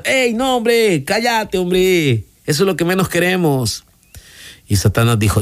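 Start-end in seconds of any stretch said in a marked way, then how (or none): 0.0-3.8 high-pitched protest of a crowd of demons, no real extra volume; mock shouting. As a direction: imitate demons crying out in objection. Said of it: "empezaron a gritar diciendo". (gritos fingidos de protesta)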